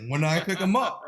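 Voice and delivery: high, loud, excited asking; can't wait to get them; eager question. Retold or imitated. Retold eager reply about tickets.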